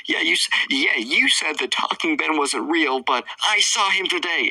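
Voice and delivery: high put-on insistent kid voice; Trevor. Boy argues with the girl, mock insistent. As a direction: protest it in a high child's voice. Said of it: "He's like".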